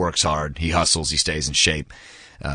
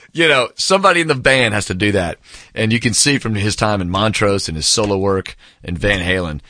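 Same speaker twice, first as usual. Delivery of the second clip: ordinary talk, nothing marked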